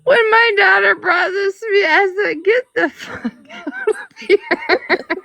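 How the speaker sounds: high words broken by laughter; laughing while talking. Real, not acted. Telling how her daughter showed her the portrait, laughing. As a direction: tell it while cracking up.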